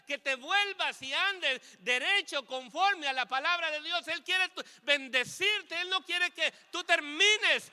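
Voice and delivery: loud, high fervent preaching; preaching at full force. Acted. Preacher urging the congregation to turn back, fervent preaching.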